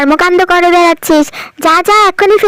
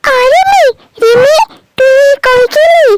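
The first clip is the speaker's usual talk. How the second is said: put-on high, fretful child calling for her friend; a small girl in a rising panic; much higher childlike voice, worried and whiny; panicky little girl; 7 semitones higher than usual. Tumpa finds lipstick stolen.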